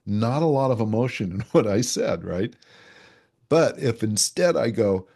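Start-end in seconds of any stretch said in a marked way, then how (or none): none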